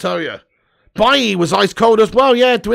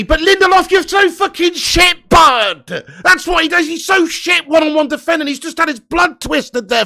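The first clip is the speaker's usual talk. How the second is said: angry shouting; 7 semitones higher than usual. Real, not acted. Fan yelling at his own centre back, angry rant. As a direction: tear into him like he can hear you.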